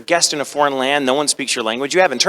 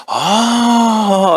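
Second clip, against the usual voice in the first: long, high, loud "oh" of awe; awed group gasp; 8 semitones higher, 4 dB louder than usual. Retold or imitated. Producers recognise the Sebastian actor, awed long "oh". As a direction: imitate a whole group's drawn-out amazement. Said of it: "And they go".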